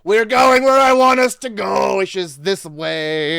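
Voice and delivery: loud, laughing, drawn-out Italian boast; drunk vineyard owner. Retold or imitated. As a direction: belt it like a tipsy host.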